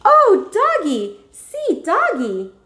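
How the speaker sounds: put-on very high, loud toddler squeal; a small child thrilled by a puppy; excited toddler. Fudge to the dog, squealing toddler voice. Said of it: "Fudge cried".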